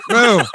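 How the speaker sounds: loud, high mock moo; cow moo.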